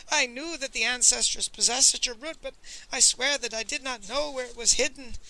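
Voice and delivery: high, loud, panicked protest; howled pleading. A narrator's reading. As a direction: plead innocence in terror, pitch held high. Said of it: "howled Pawnbroker Fang".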